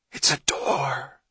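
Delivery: slow, excited hissed whisper; sudden hiss. A narrator's reading. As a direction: whisper the discovery, drawing it out. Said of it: "Johnny hissed suddenly".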